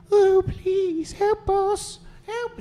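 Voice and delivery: very high pleading voice; chained mermaid pleads with a stranger, very high. Acted. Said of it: "She says".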